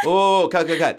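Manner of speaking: playful cry of cut on set; mock director calling cut. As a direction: halt the take like a frantic director. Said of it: "They go".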